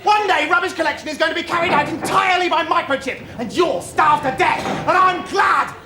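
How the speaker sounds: loud, high-pitched indignant outburst; spiteful tirade. Acted. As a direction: hurl a parting rant at the binman. Parting tirade at the binman.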